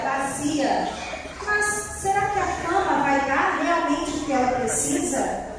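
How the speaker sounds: ordinary talk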